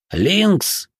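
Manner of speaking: high rasped-out cry in another voice; hoarse strange voice. A narrator's reading. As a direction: rasp it out like someone possessed. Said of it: "прохрипел он не своим голосом".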